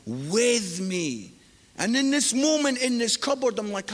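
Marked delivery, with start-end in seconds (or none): 0.0-1.3 slow, low, soft "with me"; voice of God. Retold or imitated. God speaking to him in the cupboard, slow gentle gravity. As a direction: lower and slow down, weight on "with me". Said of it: "God says to me".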